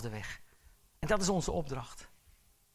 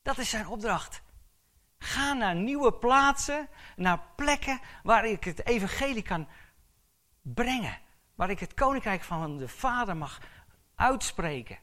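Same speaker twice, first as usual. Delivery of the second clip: preaching at full force; 8 semitones higher, 10 dB louder than usual. Acted. Preacher sending the church out, preaching at full force. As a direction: send them out with a ringing command.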